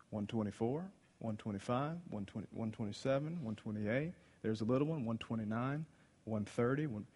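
soft, low mumbled counting; counting under breath. Acted. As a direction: count heads quietly to yourself. Philip counting the crowd.

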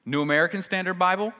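ordinary delivery

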